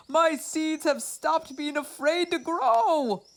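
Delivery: high, worried Toad voice, climbing at the end; Toad's voice. A narrator's reading. Toad frets over his frightened seeds, high and worried.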